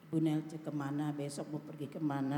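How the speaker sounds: low, soft one-note drone; monotone. Shown as a demonstration. Preacher mimicking speech without intonation, monotone. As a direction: speak it all on one pitch, no intonation. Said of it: "ada nggak orang berbicara begitu".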